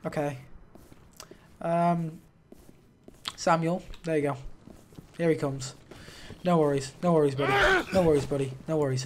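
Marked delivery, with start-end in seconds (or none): none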